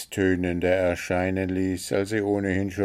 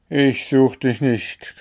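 a touch of grumpiness, louder; mild growl; 5 semitones higher, 5 dB louder than usual. A narrator's reading. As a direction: grumble it firmly, only mildly cross. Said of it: "knurrte der Wichtel". (leicht geknurrt)

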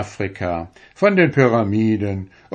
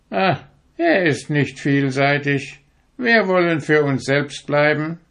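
haughty turkey; 7 semitones higher than usual. A narrator's reading. Turkey dismisses stork.

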